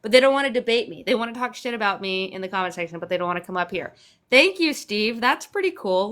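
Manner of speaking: ordinary talk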